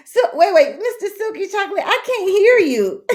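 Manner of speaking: laughing through the words; cracking up while talking; real laughter, fast. Real, not acted. Host laughing at caller.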